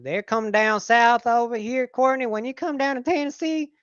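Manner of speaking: mock Southern good ol' boy inviting Courtney to Tennessee; Southern accent. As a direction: put on a thick Southern accent, hammed up. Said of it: "they had a southern accent".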